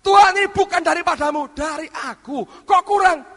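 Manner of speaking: high, loud, fast protest; loud complaint. Retold or imitated. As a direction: argue back at God. Complaining to God. (protes keras dan cepat kepada Tuhan)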